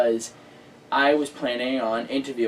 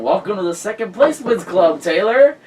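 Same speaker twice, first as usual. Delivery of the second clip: higher, louder teasing quip; mock commentary voice; 6 semitones higher, 6 dB louder than usual. Commentator voicing a driver's teasing bump, mock. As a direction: voice the driver taunting her, cheeky. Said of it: "that's Tom Gray saying".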